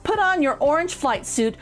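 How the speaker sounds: usual speaking voice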